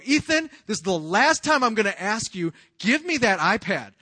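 re-enacted exasperated telling-off; yelling dad. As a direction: scold your kid as a final warning. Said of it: "I'm just yelling at them".

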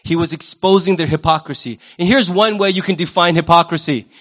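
ordinary talk

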